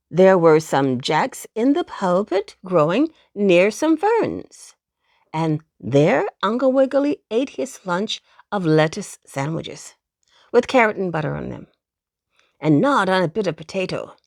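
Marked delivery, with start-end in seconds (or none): none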